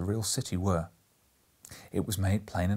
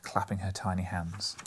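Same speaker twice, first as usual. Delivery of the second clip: plain reading voice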